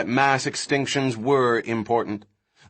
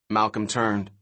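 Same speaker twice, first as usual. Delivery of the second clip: ordinary delivery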